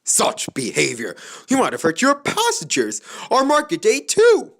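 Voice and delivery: from firm to very high, loud outrage; scolding building to outrage. A narrator's reading. Skarloey scolding the coaches, rising to a loud outburst. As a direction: work up into an indignant tirade. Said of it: "he scolded".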